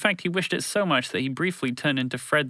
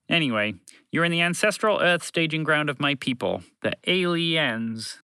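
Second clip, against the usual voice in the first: higher voice, explaining matter-of-factly; slightly high alien, drawing out the last word; lightly voiced alien creature; 6 semitones higher than usual.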